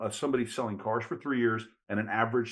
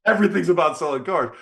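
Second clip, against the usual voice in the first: laughing; 5 semitones higher, 11 dB louder than usual. Real, not acted. Laughs at Benny's car-filled timeline.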